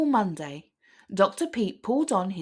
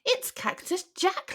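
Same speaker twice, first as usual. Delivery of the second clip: worried lizard; 11 semitones higher than usual. A narrator's reading. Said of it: "Liz cried".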